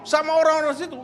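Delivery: ordinary talk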